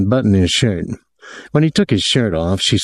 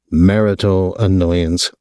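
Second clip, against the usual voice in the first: ordinary delivery, nothing marked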